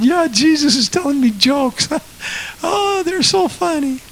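high, giddy mocking imitation of a woman. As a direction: mimic a woman giggling about jokes. Preacher mimics woman laughing in spirit.

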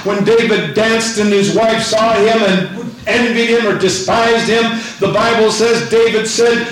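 high, shouted exultant sermon; full-force preaching. Real, not acted. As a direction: drive each phrase home to rouse the congregation.

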